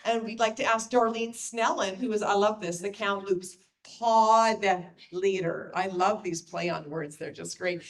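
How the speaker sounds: ordinary delivery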